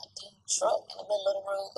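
fast hushed whisper; muttered whisper. Real, not acted. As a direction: mutter it under your breath. Muttering about road.

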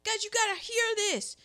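high, eager call to the group; mock-excited girl gathering friends. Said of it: "she's like".

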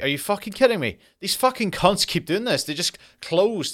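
high, incredulous mock fury; retelling his outburst at the closed underground, high and incredulous; angry rant. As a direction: vent at the useless trains, pitch high. Raging at the closed station.

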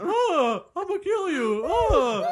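mock threat.

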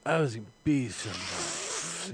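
slow, low, mopey slacker; burnout stoner voice. Acted. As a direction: drag it out like a wasted burnout. Acting a burnt-out John Connor.

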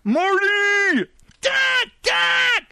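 squealed, very high-pitched teasing call; mock movie cry. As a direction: call out like a hammed-up film hero. Mimicking movie shouts at co-worker.

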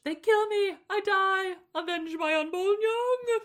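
high, loud, hammed-up dying cry; mock dying cry. Dying dragon calling the others, hammed-up loud cry. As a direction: blast it out as a dramatic last message. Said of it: "she blasts out her message".

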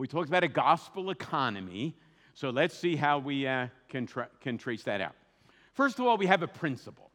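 ordinary talk

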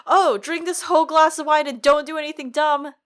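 high, loud mock-cheerful advice; mocking quote. Mocking the commander's foolish advice.

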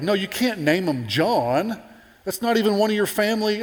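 indignant relatives. Acted. Relatives arguing with Elizabeth.

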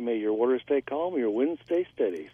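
normal talking voice

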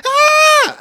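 loud, very high-pitched mock shriek; little-girl scream. Teacher imitates a man screaming at a snake, shrill girlish scream. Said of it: "he sounded like a little girl".

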